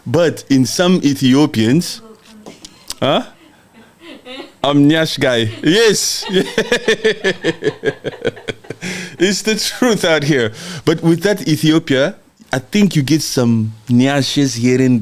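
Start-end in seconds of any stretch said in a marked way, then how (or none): none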